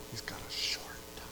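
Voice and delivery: hushed secret whisper. Acted. Preacher to the congregation, a hushed whisper. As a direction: whisper it like a warning.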